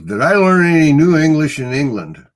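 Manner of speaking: a lightly voiced friend asking; quoting a friend. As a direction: playfully voice the buddy's question. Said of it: "he goes".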